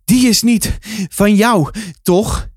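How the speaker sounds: high, slow, puffing between words; panting. A narrator's reading. Guard catching up with narrator on foot, panting. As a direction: gasp it out, winded. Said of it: "hijgde hij".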